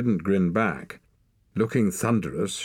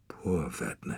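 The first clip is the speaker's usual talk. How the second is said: soft whispered thought; a boy's hushed pity for a grieving mother; inner thought; 5 dB quieter than usual. A narrator's reading. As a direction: breathe it out as pity to yourself. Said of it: "he thought".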